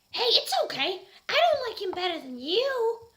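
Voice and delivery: put-on high, loud girly comfort; reassuring. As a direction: soothe him, big and sweet. Reassuring Draco.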